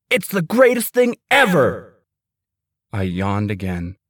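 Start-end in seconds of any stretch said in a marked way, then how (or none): 0.1-1.9 excited. Acted. Brother thrilled about snow day.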